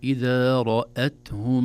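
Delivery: measured tajweed reading; slow Quranic recitation. Shown as a demonstration. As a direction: recite إذا رأتهم slowly. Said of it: "قاعدة التجاور والتأثير".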